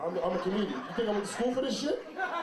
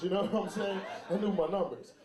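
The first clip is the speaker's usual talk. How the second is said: normal talking voice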